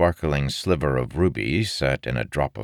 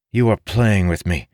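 dissatisfied; 6 semitones higher, 6 dB louder than usual. A narrator's reading. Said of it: "Marin said with dissatisfaction".

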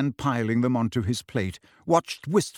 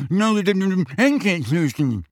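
mumbled through packed mouth; slow, garbled by food; mouth full; 6 semitones higher, 4 dB louder, much slower than usual. A narrator's reading. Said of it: "Ron's mouth was packed to exploding point".

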